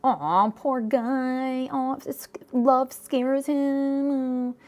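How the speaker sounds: high, very slow cooing pity; syrupy put-on sympathy; mock sympathy. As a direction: coo it with exaggerated pity, stretching the words. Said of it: "I'm not saying".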